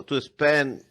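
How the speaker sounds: ordinary talk, nothing marked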